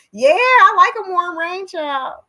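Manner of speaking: high, loud, happy gush; delighted. Real, not acted. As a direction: gush about the warm rain. Delighted about warm rain.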